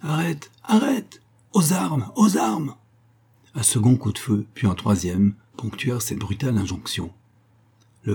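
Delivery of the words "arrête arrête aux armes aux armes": high, urgent cry to halt; drawn-out, high-pitched call to arms; alarm call. A narrator's reading. Pursuers calling out the alarm.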